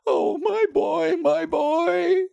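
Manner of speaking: slow, very high, desperate plea; anguished urging. A narrator's reading. Wife pleads for her dead boy, anguished. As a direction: urge him, then wail in grief. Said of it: "she panted".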